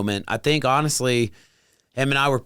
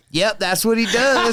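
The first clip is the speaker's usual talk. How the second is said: laughing mimic of wife; 8 semitones higher than usual. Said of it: "she goes".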